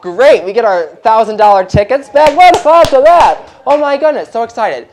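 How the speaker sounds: loud, high mock cheer with sarcasm; sarcastic audience cheer. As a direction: yell the fake joy like a sarcastic crowd. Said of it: "the whole audience is like".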